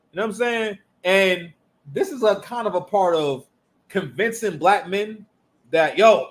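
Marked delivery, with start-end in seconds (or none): none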